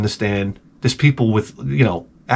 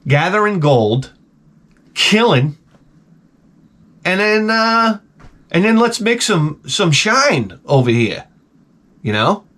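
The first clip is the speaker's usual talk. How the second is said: ordinary talk, nothing marked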